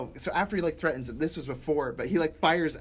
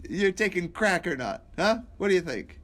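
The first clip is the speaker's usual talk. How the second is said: mock accusation; 6 semitones higher, 4 dB louder than usual. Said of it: "that's like me saying to you".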